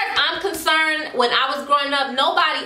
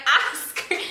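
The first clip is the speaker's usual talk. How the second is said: normal talking voice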